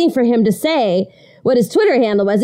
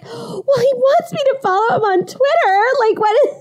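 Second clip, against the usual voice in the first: high whiny voice; 14 semitones higher than usual. Retold or imitated. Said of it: "And I'm like".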